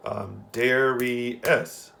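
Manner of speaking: Darius drawn out beat by beat; teacher counting syllables aloud in Darius; slow syllable demo. Shown as a demonstration. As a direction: sound out each syllable of Darius slowly. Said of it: "That's how many beats".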